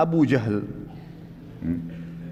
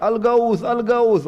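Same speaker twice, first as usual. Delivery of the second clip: high, raised cries for help; retold cry of alarm; 7 semitones higher, much slower than usual. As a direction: keep calling for help to the crowd. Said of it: "dia terus teriak". (teriakan minta tolong bernada tinggi)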